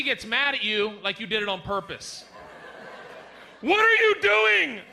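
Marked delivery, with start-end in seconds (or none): 3.6-4.8 high, loud, slow shrill demand; angry wife. Acted. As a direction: yell at him like a furious wife. Wife yells at fallen husband.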